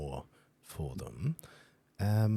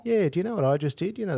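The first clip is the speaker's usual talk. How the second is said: higher, louder and fast, keyed up; excited outburst; 13 semitones higher, 8 dB louder, faster than usual. Real, not acted. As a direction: blurt it out, fired up. Bursting out after the connection drops.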